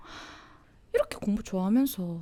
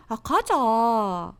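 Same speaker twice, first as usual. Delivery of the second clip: long, drawn-out coaxing; pleading whine; 4 dB louder, much slower than usual. Acted. Nagging a friend to come along, drawn-out pleading whine. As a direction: stretch it out slowly, begging.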